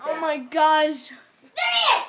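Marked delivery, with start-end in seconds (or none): 1.7-2.0 excited squeal. Real, not acted. Spotting the fly again.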